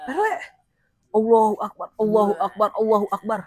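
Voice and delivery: ordinary talk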